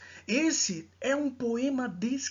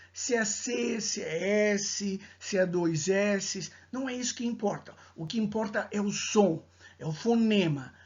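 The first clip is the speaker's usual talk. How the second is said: ordinary delivery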